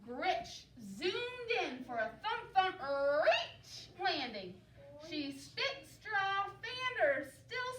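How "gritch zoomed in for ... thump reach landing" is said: ordinary delivery, nothing marked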